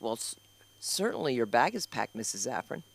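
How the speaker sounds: low, soft mock nurse asking; hint of a hushed prim nurse. Nurse voiced in standup story.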